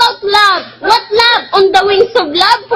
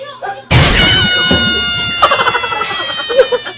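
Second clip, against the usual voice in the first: high shriek of laughter; losing it mid-skit; high laughing shriek; 9 semitones higher than usual. Real, not acted. Laughing at the fake quarrel.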